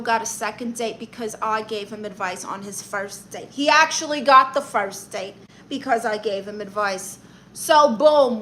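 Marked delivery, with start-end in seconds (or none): none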